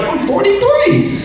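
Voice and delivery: high, slow, laughing comeback; laughing retort. Retold or imitated. Buyer answering the shirt hustler, high and laughing. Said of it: "You're like".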